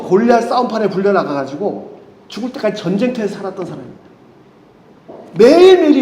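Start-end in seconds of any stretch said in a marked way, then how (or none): 5.4-6.0 매일매일이 thrown out higher and louder; emphatic cry. Acted. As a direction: hit 매일매일이 hard and high. Stressing David's daily danger.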